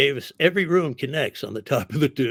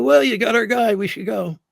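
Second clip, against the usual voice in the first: higher, louder, breezy joke to the cops; cheeky mock suggestion; 8 semitones higher, 6 dB louder than usual. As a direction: toss it off like it's obvious we can leave. Joking to cops at arrest.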